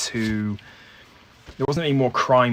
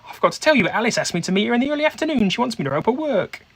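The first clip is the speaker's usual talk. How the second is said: much higher, quick flustered excuse; flustered Maisie; 11 semitones higher, faster than usual. Acted. Maisie makes a quick excuse to her companion, high and flustered. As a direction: talk fast to dodge the question.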